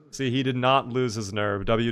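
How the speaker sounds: usual speaking voice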